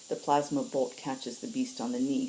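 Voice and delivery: usual speaking voice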